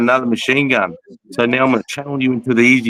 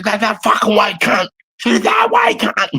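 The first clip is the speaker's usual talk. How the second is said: retold yelling; 12 semitones higher than usual. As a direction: bark directions like a soldier under fire. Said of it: "screaming at his buddy on the machine gun".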